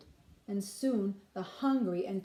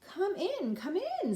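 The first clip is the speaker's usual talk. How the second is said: put-on welcoming old man; 10 semitones higher than usual.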